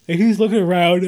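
talking through a yawn. Real, not acted. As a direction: say it mid-yawn, stretched out. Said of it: "Oh, I'm yawning".

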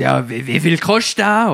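loud Swiss-dialect question; Swiss officer's demand. Retold or imitated. Swiss customs officer asking about the present's price, loud dialect. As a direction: ask it curtly in Swiss dialect.

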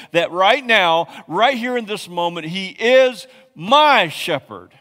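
high, loud, slow proclamation; full-force preaching peak; preaching shout. Acted. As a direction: cry it out to the church.

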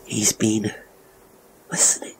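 hushed, trailing whisper; soft, halting realization. A narrator's reading. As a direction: let the words sink into a whisper. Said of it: "She whispered the last words".

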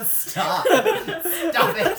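high laughing protest. Real, not acted. GM laughing at players recruiting the NPC, high and loud. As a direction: protest while cracking up.

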